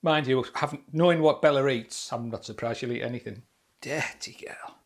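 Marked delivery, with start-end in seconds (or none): 3.8-4.8 whispering. Real, not acted. Whispered aside about the dog.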